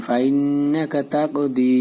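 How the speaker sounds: ফাইন্নাকা with a held humming "nn"; slow ফাইন্নাকা তাকদি, final "di" stretched; ghunnah and madd. Shown as a demonstration. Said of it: "এখানে গুন্না". (ফাইন্নাকা তাকদি, গুন্না ও টান দিয়ে)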